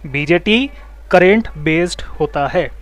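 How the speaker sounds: "BJT", a pause, then "current based होता है" word by word; slow dictation. Shown as a demonstration. Teacher repeats the point for students' notebooks, slow dictation of "BJT current based होता है". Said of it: "copy में एक चीज़ और लिख सकते हो".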